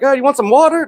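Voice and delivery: high mock offer of water; lightly hammed-up helpless coaxing; coaxing a toddler. Sitter retelling how he offered water to the crying girl, high mock coaxing. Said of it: "I'm like".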